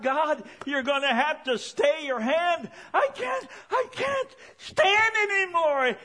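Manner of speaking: very high, tearful shout to God; bawling plea. Retold or imitated. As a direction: cry out as if overwhelmed, imitating sobs. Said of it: "began to weep and cry and bawl".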